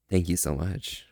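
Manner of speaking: ordinary talk, nothing marked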